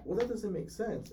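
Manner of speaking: high-pitched imitation of a dismissive customer; rude customer. Customer arguing back at the salesman, mock rude. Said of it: "she was being, like, super rude".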